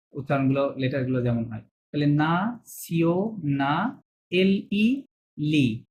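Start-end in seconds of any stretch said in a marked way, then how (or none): 1.6-5.8 না সিও split into syllables, very slow. Shown as a demonstration. Teacher breaking nationalité into parts for students, very slow. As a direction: break না সিও apart slowly. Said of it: "ছোট ছোট করে ভেঙে ভেঙে".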